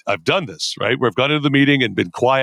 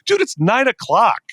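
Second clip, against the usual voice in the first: mock incredulous; 11 semitones higher than usual. Acted incredulous colleague questioning his anger.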